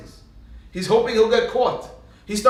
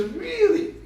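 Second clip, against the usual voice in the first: high, disbelieving little question; mock surprise; 14 semitones higher than usual. Father challenges his child's claim, high mock surprise. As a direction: lift it way up as if astonished.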